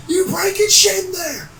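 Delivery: high-pitched imitation of an angry yell; retold screaming. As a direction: screech it as the man did. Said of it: "He's just screaming at me".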